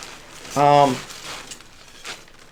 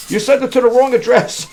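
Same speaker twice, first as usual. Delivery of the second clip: imitating a panicked buyer, pitched higher and louder; mock the worried buyer's alarm; panicky buyer; 11 semitones higher, 6 dB louder than usual. Buyer complaining to the seller, in a higher, louder mock panic. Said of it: "it was kinda panicky".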